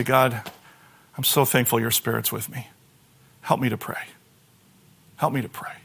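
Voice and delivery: soft, low, hushed prayer. Shown as a demonstration. Preacher demonstrating a quiet prayer to the church. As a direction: murmur it like a private prayer.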